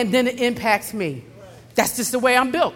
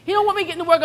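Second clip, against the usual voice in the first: normal talking voice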